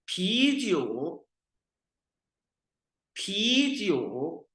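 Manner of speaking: पीजियो slowly, rising then dipping tone; slow tone drill. Shown as a demonstration. Teacher modelling पीजियो slowly for students. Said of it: "teacher drills tone word".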